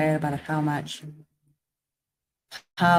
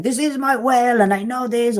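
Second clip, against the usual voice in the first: high, louder little frog boasting; smug frog; 8 semitones higher, 6 dB louder than usual. Acted. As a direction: play the proud frog in his well. Voicing the smug frog in well.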